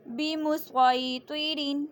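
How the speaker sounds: بمسیطرین slowly recited with a thick ص; full heavy ص sound; heavy saad. Shown as a demonstration. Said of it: "صرف اور صرف صاد پڑھنا ہے".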